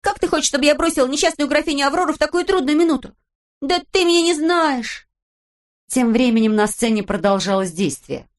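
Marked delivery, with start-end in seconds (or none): 0.0-5.1 put-on high, lively girl's voice; Pippi voice. A narrator's reading. Narrator voicing Pippi, high and perky. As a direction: play Pippi as a cheeky girl.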